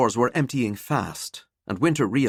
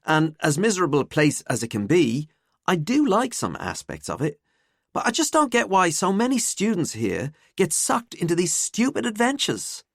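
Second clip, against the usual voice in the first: higher-pitched griping student; lightly acted student complaint; disgruntled student; 8 semitones higher than usual. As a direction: voice a fed-up pupil, pitched up.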